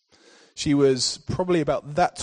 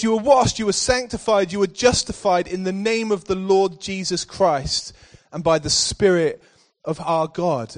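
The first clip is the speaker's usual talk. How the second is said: higher, emphatic reading; firm Bible reading, higher; scripture reading; 4 semitones higher than usual. Acted. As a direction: read the verse out with conviction. Rereading verse.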